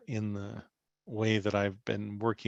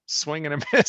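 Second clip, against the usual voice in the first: laughing; 5 semitones higher, 8 dB louder, faster than usual. Retold or imitated. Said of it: "trying not to laugh hysterically".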